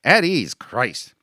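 loud, high order, easing off; exasperated command. Acted. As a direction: release the men in exasperation. Captain exasperated, releases the men.

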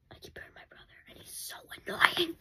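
soft unvoiced whisper; whispered aside. Real, not acted. Kid whispering to viewers about her brother. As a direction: whisper it as a secret.

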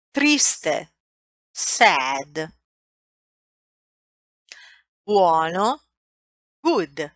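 slow word list, Italian then English; vocabulary drill. Shown as a demonstration. Teacher reads Italian vocabulary for students to repeat. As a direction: read the list with long pauses.

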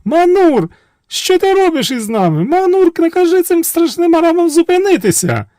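very high, loud panicked cry from a window; high frantic princess scream. A narrator's reading. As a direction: shriek to stop the destruction. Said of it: "кричить".

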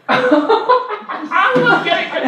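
high, slow, laughing glee; laughing mock glee. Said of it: "I'll be like".

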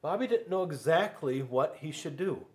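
plain reading voice

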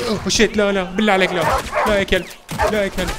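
loud, high cries of alarm; alarmed pleading. Real, not acted. Gamer to attacking dogs in game, alarmed pleading.

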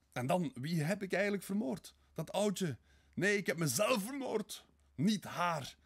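high, loud, tormented cry; anguished outburst. Acted. Murderer confesses to Sonja, anguished outburst.

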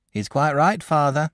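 lightly voiced practical country mother; a hint of a homely mother siding with her son; sensible mother. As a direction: settle it kindly like a sensible wife. Mother voiced in read story.

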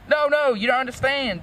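high-pitched, mildly desperate plea; pleading. A narrator's reading. Draco begs his angry girlfriend to listen, pleading. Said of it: "Draco pleaded".

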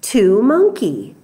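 ordinary delivery

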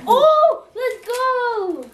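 thrilled shout of joy; excited cheer. Real, not acted. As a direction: cheer at the gift. Dziecko cieszy się z prezentu.

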